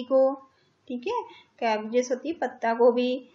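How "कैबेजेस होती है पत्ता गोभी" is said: ordinary talk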